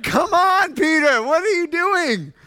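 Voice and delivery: high, loud, exasperated shout; exasperated Jesus. Retold or imitated. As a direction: shout it in disbelief at a friend. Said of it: "He's like".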